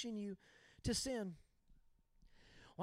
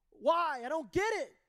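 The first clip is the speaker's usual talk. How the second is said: frustrated protest; 3 semitones higher, 5 dB louder than usual. Retold or imitated. Son pleading with dad again, a louder frustrated protest. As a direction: demand an answer.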